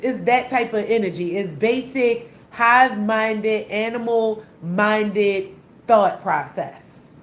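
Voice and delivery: normal talking voice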